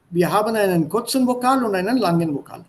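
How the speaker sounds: normal talking voice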